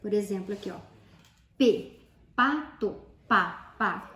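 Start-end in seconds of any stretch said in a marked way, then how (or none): 1.6-4.1 slow, loud sounding-out of P, pato, pá; P, pato, pá spoken slowly as separate, emphatic sounds. Shown as a demonstration. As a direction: say P, pato, pá piece by piece, with gaps. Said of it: "ele trabalha o som da palavra".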